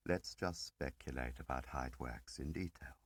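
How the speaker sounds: plain reading voice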